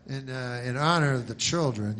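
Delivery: normal talking voice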